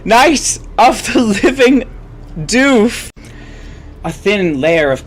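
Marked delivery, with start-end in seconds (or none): 0.0-3.1 dramatic title. Acted.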